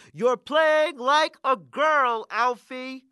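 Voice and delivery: high, drawn-out mocking holler; coach's holler. Bully coach to skinny kid across the court, hollering. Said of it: "the coach on the other side of the court hollers".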